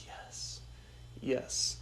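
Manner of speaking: hushed, breathless whisper of relief; whispered yes. Retold or imitated. Seeing Jake under the light, whispered relief. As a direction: whisper it like a prayer answered.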